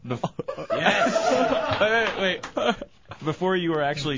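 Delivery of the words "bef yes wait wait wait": nervous laugh. Real, not acted. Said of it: "the most nervous laugh".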